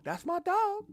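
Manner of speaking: high squeaky imitation of Smoke; Smoke quote. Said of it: "And, and Smoke goes".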